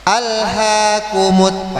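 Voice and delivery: "Alhaakumut" with the "haa" stretched slowly; long mad tabi'i. Shown as a demonstration. ("Alhaakumut" dengan mad dibaca panjang)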